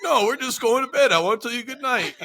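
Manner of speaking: high, giggly voice with a laugh breaking through; a friend who can't hold back laughter on the phone; laughing while talking. Retold or imitated. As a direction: say it while cracking up. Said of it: "And he was laughing".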